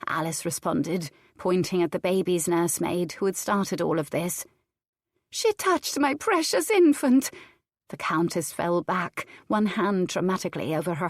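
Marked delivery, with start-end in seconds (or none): 5.3-7.3 aghast. A narrator's reading. Countess in theatrical horror.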